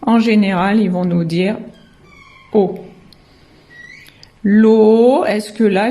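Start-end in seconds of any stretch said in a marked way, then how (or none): 4.4-5.2 "L'eau" louder, vowel held long and rising; stretched syllable. Shown as a demonstration. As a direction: draw out "L'eau" as looo. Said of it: "j'ai écrit l'eau".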